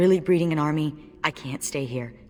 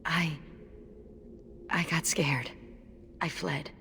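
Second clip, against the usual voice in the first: whispered confession; 8 dB quieter, slower than usual. Acted. Confessing fear after the massacre.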